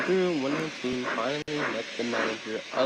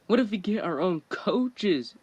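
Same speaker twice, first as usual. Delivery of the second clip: excited; 8 semitones higher, 4 dB louder than usual. Acted. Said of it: "said one nineteen excitedly".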